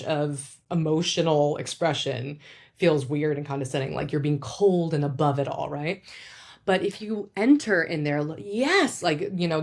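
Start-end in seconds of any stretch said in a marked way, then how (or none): none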